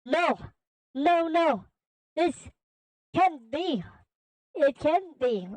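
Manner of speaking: high, halting panicked denial; acted fear, broken into short bursts; fearful villain. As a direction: stammer in disbelief, pitch held high. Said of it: "Infinite then began shaking in fear".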